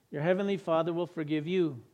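ordinary delivery

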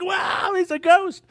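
high, loud mock alarm; hammed-up startled cry; frightened disciples. Disciples spotting a figure on the water, mock-scared high cry. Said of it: "And they're like".

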